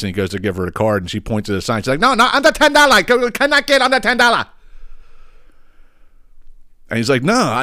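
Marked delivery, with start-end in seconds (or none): 2.0-4.5 high-pitched, loud broken-English cashier. Retold or imitated. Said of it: "spoke as broken English as the lady did". 7.2-7.6 mock protest, pitched up; retold screaming customer. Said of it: "he's actually screaming about it".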